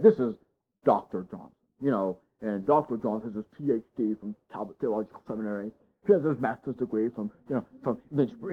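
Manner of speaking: low-key credential reel-off; mock flattering introduction. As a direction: pitch dropped, mock-reverent guest introduction. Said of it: "just butter the guy up real good".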